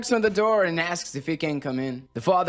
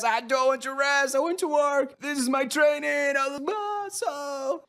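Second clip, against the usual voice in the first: high, strained hammed-up wail; protesting orc; 10 semitones higher than usual. Orc dragged away from his work, wailing protest. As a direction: cry out in a high voice, drawing out the last words.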